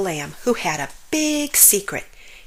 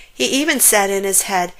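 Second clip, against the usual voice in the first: ordinary delivery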